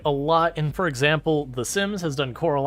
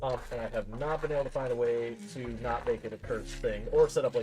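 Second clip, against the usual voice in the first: muffled; 3 semitones lower, 7 dB quieter than usual. Real, not acted. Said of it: "I do apologize if my voice is muffled".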